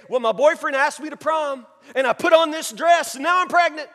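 high, loud, naive protest; excuse-making girl. Acted. Girl explaining her pregnancy, mock-innocent. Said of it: "You ever heard the girl say".

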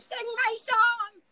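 squealed high and slow, like a begging fan; mock fan voice. Mimicking a fan's voice message, a high slow squeal. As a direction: ham up a fan pleading for a song. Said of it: "They're gonna send, like, voice messages".

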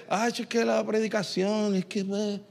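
queja floja, bajando la voz; queja desganada. Acted. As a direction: quejarse sin ganas, más bajito. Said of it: "llegas al culto y dices". (imitar una queja desganada en voz baja)

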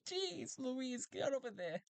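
very high robot exclamation; strange robot. Acted. Robot urging Talty over, very high voice. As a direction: yelp it out way up high.

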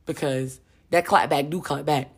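low, slow sayin' with weight; a slow street-wisdom warning; slow knowing warning. Shown as a demonstration. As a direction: drop it low and let it land like a proverb. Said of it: "watch how you say it for sure".

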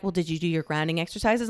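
low, soft, quick therapist voice; therapist's routine question. Retold or imitated. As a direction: imitate a counsellor checking in quietly. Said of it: "and they'll be like".

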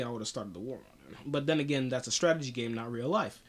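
ordinary delivery

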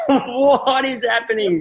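high, slow, laughing; stunned laugh at the absurdity; laughing disbelief. Retold or imitated.

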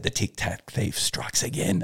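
soft, slow, half-whispered quip; hushed cheeky line; sly quip. Retold or imitated. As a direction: murmur it with a wink. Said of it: "I walked past them and I said".

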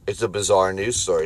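normal talking voice

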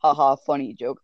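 usual speaking voice

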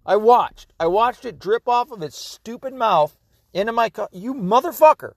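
real high-pitched exasperated outburst; upset, outraged. Real, not acted. Man cursing his dog for drooling in his coffee, outraged. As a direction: scold a misbehaving pet, voice jumping up high. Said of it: "Now I'm so upset".

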